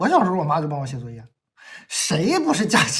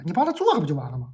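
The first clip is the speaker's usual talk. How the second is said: usual speaking voice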